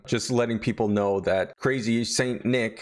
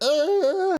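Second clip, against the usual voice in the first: high loud hammed-up yell; Santa's pained yell; mock scream; 4 dB louder than usual. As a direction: yelp as the door slams on him. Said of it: "He's like".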